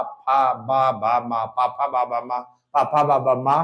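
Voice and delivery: teacher drilling lip consonants; lip sounds demo. Shown as a demonstration. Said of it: "होंठ चिपक रहे दोस्तों".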